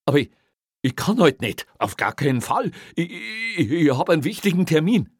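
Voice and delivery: high, loud, stammering objection; agitated protest. Acted. Young officer objects to a sudden night shift, agitated. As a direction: protest in rising panic, tripping over "ich".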